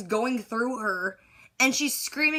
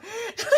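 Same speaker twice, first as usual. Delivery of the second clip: high, sudden burst of laughter; a giggle that breaks out; 12 semitones higher than usual. Real, not acted. Laughs at the doctor's line.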